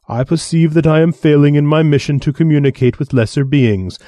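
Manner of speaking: lofty, condescending alien voice; haughty alien envoy. Acted. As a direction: sneer down at lesser beings. Alien envoy lecturing humans.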